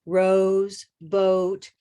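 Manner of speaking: "Rose, boat" with lips rounding and closing on the vowel; moving O sound. Shown as a demonstration. Teacher modelling the moving O for students. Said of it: "Try that one".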